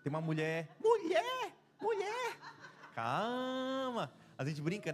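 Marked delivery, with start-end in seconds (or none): 0.8-4.1 agudo, rindo, pedindo calma; esposa acalmando, rindo. Retold or imitated. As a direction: imite a esposa com voz bem alta e risonha. (esposa brincando: "calma", agudo e rindo)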